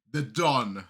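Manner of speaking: ordinary talk, nothing marked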